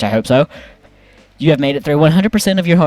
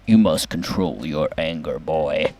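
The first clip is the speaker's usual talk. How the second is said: much lower, put-on gravelly god-of-war growl; deep stern warrior father, slow and heavy; Kratos voice; 8 semitones lower, 5 dB quieter, slower than usual. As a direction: growl it down low like Kratos scolding his son. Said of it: "Also Kratos".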